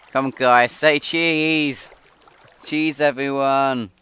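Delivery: loud, high photographer call; rally everyone for the picture. Real, not acted.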